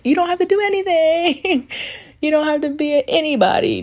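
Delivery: high, loud sing-out; playful divine voice. Retold or imitated. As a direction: voice the divine as a high, carrying call.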